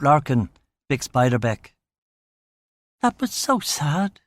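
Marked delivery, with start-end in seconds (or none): 3.0-4.2 Sarah's voice, wistful. A narrator's reading. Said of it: "she said".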